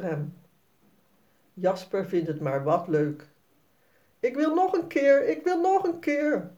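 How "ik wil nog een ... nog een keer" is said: put-on much higher, eager child's cry; thrilled little boy calling out; excited call. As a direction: beg to do it once more. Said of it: "roept Jasper".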